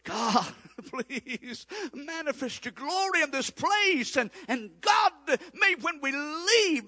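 high, slow, lifted prayer voice; fervent prayer. Acted. Pastor praying over the church, high and slow. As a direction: lift the words up to God, slowly.

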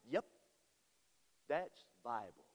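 ordinary delivery

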